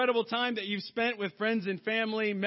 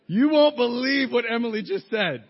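excited; 4 semitones higher, 10 dB louder than usual. Retold or imitated. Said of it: "I'm just like".

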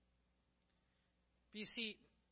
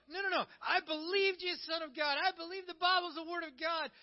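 high, loud insistence; defensive protest; 10 semitones higher, 6 dB louder than usual. Acted. Believer defends their faith to someone urging growth, defensive and insistent. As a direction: protest emphatically, pitched well up.

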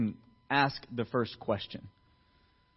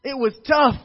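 put-on louder, higher, slow boastful old man's rant; mocking boastful old-timer; 6 semitones higher, 7 dB louder than usual. Old men boasting to the younger crowd, hammed-up. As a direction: ham up a bragging old-timer. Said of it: "they're like".

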